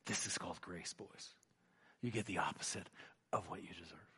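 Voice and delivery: tender explanation. Retold or imitated. Father gently explaining grace.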